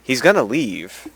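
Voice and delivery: normal talking voice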